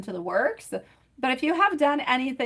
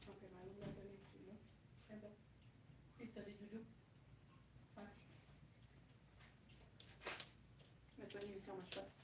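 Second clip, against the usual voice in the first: much softer French, away from the microphone; distant, softer aside in French; off mic; 7 dB quieter, faster than usual. Real, not acted. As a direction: turn away and talk to your daughter off mic. Turns away to talk to daughter.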